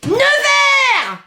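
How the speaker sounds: high, drawn-out yelled refusal; defiant shout. Acted. Child refusing father's order in English, defiant shout. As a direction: yell it back, stretching the word.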